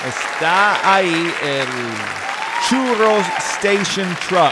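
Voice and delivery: slow, loud drawn-out announcement; announcer drawl. Acted. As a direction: stretch out the announcement. Announcing the food truck.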